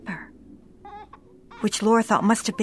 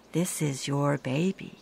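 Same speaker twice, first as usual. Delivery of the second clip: tender; 6 semitones lower, 6 dB quieter than usual. Acted. Handing father his lost baby.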